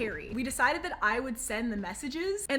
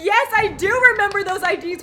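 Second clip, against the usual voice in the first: mock laughing reply; 6 semitones higher, 12 dB louder than usual.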